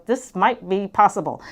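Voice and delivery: ordinary talk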